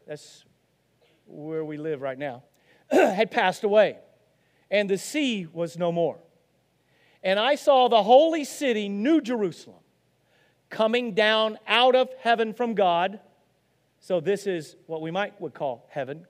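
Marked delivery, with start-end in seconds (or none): 2.9-13.2 loud, raised, declaimed scripture; scripture reading. Acted. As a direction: proclaim the holy text to the congregation. Reading scripture aloud to congregation.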